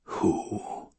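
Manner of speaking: unvoiced, softened croak; barely find the voice, rasping; stupefied, breath-only rasp. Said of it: "it was only to rasp".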